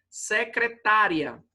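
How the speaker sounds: "Secretária" drawn out, stressed on TÁ. Shown as a demonstration. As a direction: say "Secretária" slowly, leaning on the penultimate TÁ. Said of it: "esse tá vibrou mais".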